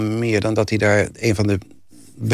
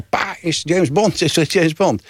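little son asking; 7 semitones higher, slower than usual. Retold or imitated. Little son calling to his father.

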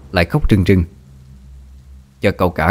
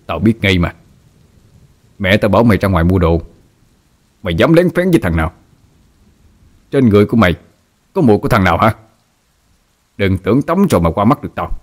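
shouting. A narrator's reading. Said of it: "hét lên".

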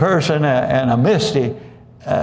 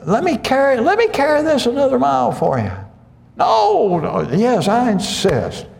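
high-pitched, hammed-up pleading offer; eager insistence; 11 semitones higher than usual. Helper begging to carry it another mile, eager insistence. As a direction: insist keenly on doing the favor.

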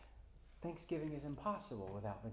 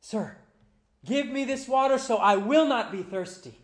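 much higher, loud desperate cry; desperate plea; 10 semitones higher, 17 dB louder than usual. Acted. Thirsty sinner begging Jesus for water, desperate loud plea. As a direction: beg for the water like you're parched. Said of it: "desperately, thirstily".

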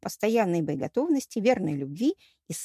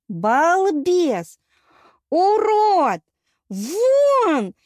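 very high, loud screamed insults; siren-like howl; 9 semitones higher, 8 dB louder, much slower than usual. A narrator's reading. Goddess throwing the suitor out, howling like a siren. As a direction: wail each word like a siren. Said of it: "сиреной взвыла Полина".

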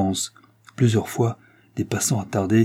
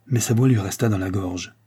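ordinary delivery

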